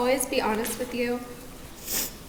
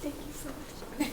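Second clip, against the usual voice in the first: much softer, slow, choked up; tearful thanks; 2 semitones higher, 12 dB quieter, slower than usual. Real, not acted. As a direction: barely get the thanks out through tears. Bride crying mid-vows, thanks guests.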